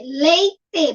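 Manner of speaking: LEITE slowly split, LEI, TE; syllable by syllable. Shown as a demonstration. Teacher picks two-syllable food, syllable by syllable. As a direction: say LEITE in two drawn-out beats. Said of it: "têm duas sílabas".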